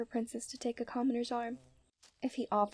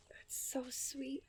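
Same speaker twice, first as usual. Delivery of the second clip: softer, slow whispered "that's so sweet"; tender whisper; 8 dB quieter, much slower than usual. Acted. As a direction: breathe it out, moved by his parents' love. Tender reaction to family story.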